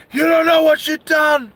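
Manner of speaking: high-pitched furious outcry; angry shout. Acted. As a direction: cry out in rage at the killers. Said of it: "And he's so angry".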